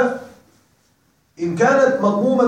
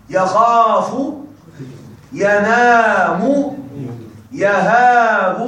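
drawn-out prompts; much slower than usual. Shown as a demonstration.

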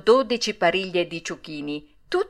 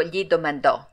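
usual speaking voice

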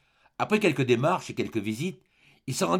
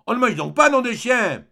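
loud, high impatient yell; angry shout through the house; vociferating; 9 semitones higher, 8 dB louder than usual. A narrator's reading. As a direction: holler for the meal with a curse. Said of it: "il vociféra".

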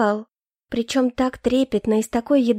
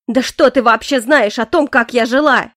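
louder bitter outburst; angry cry; 6 dB louder than usual. A narrator's reading. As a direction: hurl it at him.

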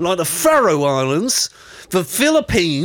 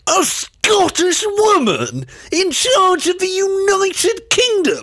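mock outrage; 13 semitones higher than usual. Mock outrage at Scottish suggestion.

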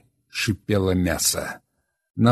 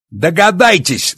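biting sarcasm, much higher and louder, slow; sarcastic; 10 semitones higher, 8 dB louder, slower than usual. Acted. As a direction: spit it out scornfully. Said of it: "сарказмом его голоса".

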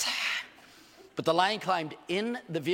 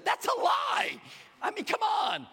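high-pitched shout of protest; indignant outburst; slower than usual. Real, not acted. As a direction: shout it in disbelief, voice way up. Indignant at phone companies' denial.

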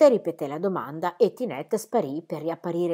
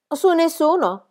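put-on plain child answer; 10 semitones higher, 5 dB louder than usual.

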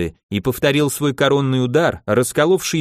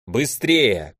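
ordinary delivery, nothing marked

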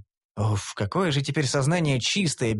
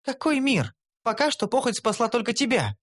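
higher, deflating retort; elf putting him down; 8 semitones higher than usual. A narrator's reading. Elf deflating hero's boast, higher retort. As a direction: cut him down to size. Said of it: "попыталась меня осадить Кара".

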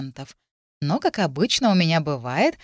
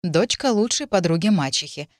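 plain reading voice

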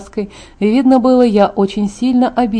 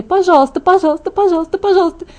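rapid-fire chain of high pleas; excited begging; 9 semitones higher, faster than usual. A narrator's reading. Friend bouncing with excitement, begging eagerly. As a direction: beg over and over, pitched way up. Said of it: "подпрыгивала на месте от возбуждения".

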